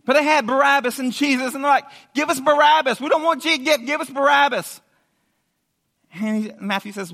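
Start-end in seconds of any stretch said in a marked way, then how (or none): 2.1-4.8 high, loud insistent demand; acted mob cry; crowd clamour. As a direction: shout it like a mob that won't be refused.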